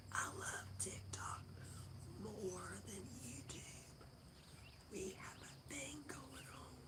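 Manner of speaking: whispered like a secret. Pretend. Telling viewers a secret about TikTok, playful whisper. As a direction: lean in and whisper it to the phone. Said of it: "I'm gonna say it out loud".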